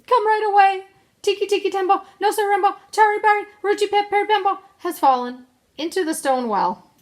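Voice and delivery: put-on high, loud urgent child's cry; little boy hollering the alarm; shouting. A narrator's reading. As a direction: shout for help, rattling off the name. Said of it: "shouted Chang".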